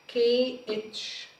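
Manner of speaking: كي اتش spelt out slowly; name كي اتش letter by letter; slow spelling. Shown as a demonstration. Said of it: "teacher spells letters slowly".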